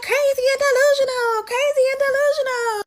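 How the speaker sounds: imitating her, pitch way up, proud of it; mocking Kalani. Kalani answering Drake's insult, high mocking voice. Kalani taunting during fight.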